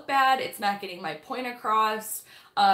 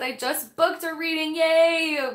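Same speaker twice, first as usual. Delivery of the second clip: excited; 7 semitones higher, 6 dB louder than usual. Retold or imitated. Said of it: "Rebecca said".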